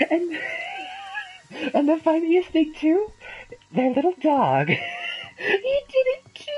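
high, squealing laughter through the words; can barely speak for laughing; laughing hard. Real, not acted. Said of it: "I know I shouldn't laugh".

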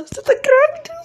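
high, fast shocked question; can't believe the news; mock-stunned high question; surprised. Husband hears pregnancy news.

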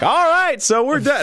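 high, mock cut-off call; retold interruption. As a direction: imitate someone loudly calling time on a joke. Retold interruption cutting off the punchline.

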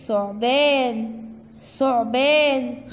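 ثعبان stretched very slowly, syllable by syllable; drawn out. Shown as a demonstration. Teacher drawing out ثعبان for kindergarten kids. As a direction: draw out ثعبان for the children. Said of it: "صورة إيه؟".

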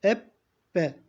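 Ebbe with the b held double, eb-be; Ebbe, b said twice; doubled b. Shown as a demonstration. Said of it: "Harfi iki defa okutuyor".

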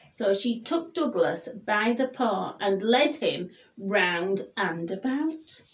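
plain reading voice

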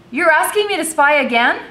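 high, loud incredulous question; indignant disbelief. A narrator's reading. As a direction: throw the question back in outrage.

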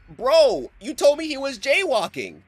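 mock an outraged teacher; indignant disbelief. Teacher confronting the cops.

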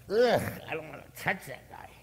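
squeamish, hushed-off refusal; acted disgust. Priest recoiling from the beaten man, disgusted.

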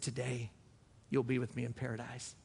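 hushed and tender. Real, not acted. Tender retelling of Jesus' promise.

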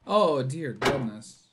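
usual speaking voice

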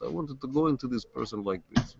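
put-on Russian accent. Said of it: "That just comes out just like that".

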